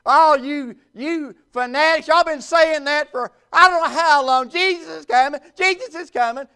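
scornful singsong parroting, high; mocking scoffers. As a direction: imitate scoffers jeering at a preacher. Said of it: "they laugh and they mock".

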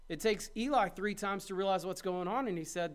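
ordinary talk

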